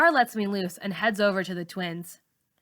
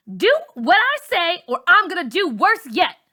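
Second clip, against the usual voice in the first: loud, much higher threatening order; angry threat; 10 semitones higher, 9 dB louder than usual. A narrator's reading. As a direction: bark the threat at her. Char threatens with lighter.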